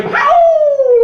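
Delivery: high loud howl. Pretend. Hype man howling at the crowd, high loud howl. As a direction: let out a wild howl. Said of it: "make you scream".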